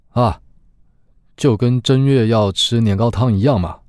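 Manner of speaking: a hint of a casual younger man; Xiaoba answering. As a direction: toss off the answer lightly. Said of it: "一旁的小八回答". (小八随口回答的语气)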